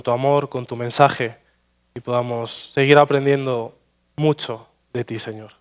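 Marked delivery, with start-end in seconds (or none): none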